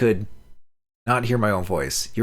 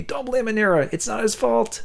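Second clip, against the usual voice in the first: high pleading voice. Acted. Dying friend's plea voiced.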